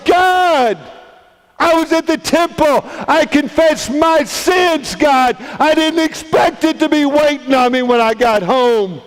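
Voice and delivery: high, anguished yell at God; angry screaming. Acted. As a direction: scream your grievance to heaven. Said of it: "so angry he is screaming. And he yells".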